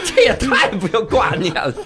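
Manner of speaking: real laugh running into speech; laughing banter. Real, not acted. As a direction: tease while cracking up. Listener cracks up at dark joke.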